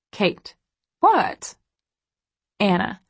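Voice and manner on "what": high chat voice. A narrator's reading. Chat reading, Kate's voice.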